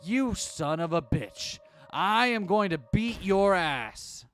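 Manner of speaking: loud, very slow snarling threat; enraged. Acted. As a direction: snarl it like an animal. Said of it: "Susumu snarled like an animal".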